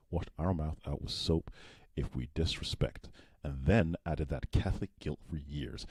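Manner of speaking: slow, soft attempt at an American accent; careful put-on American accent, slowly. Shown as a demonstration. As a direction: try reading it in American. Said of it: "Now read that in American accent".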